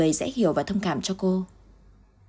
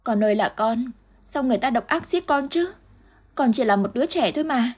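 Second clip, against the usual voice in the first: wailing in grief; 6 semitones higher than usual. Acted. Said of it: "Dung bỗng khóc òa lên".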